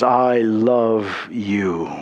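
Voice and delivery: very low and slow, lingering on each word; tender whisper of love. Acted. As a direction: breathe it out tenderly, like a father to a child. God's tender words repeated.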